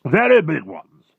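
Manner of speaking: slow, high, loud military boast; proud boast. Acted. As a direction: boast loudly as a proud Air Force chief. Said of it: "said proudly".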